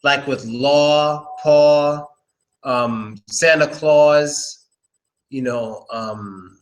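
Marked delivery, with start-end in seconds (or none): 0.0-4.7 law, paw and Claus drawn out slowly on the aw sound; slow aw examples. Shown as a demonstration. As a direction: stretch each aw word slowly. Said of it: "It's an aw sound".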